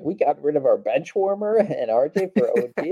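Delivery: high, laughing fake-happy voice; laughing mock quote. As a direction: giggle through the quote. Joking quote of the team's view.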